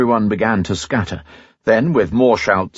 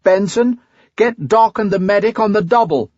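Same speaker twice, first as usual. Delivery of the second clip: calling out; 12 semitones higher than usual. A narrator's reading. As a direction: yell across the pad to Benson. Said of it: "cupped his hands around his mouth".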